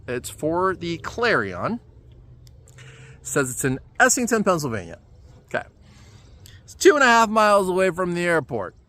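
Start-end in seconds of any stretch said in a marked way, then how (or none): none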